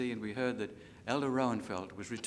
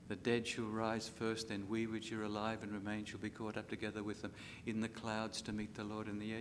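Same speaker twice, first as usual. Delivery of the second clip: soft reflective reread; 6 dB quieter than usual. Acted. Preacher rereads the verse gently to grieving listeners, soft. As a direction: let the promise sink in quietly.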